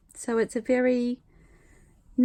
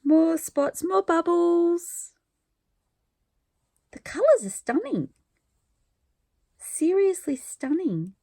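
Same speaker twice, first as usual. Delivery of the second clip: higher, louder gushing; thrilled admiring exclamations; delighted; 5 semitones higher, 4 dB louder than usual. Real, not acted. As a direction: rave over the colours. Gushing over fabrics.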